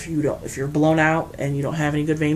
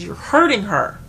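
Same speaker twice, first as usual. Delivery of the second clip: louder firm warning, stress on hurting; 4 semitones higher, 6 dB louder than usual. Retold or imitated. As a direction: cut in and tell her straight. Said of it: "And I said".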